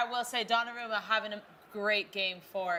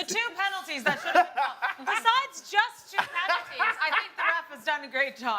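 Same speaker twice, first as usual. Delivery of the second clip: laughing through the words, higher and louder; 7 semitones higher, 4 dB louder than usual. Real, not acted. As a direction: chuckle while you say it, not quite serious. Sarcastic joke about the ref.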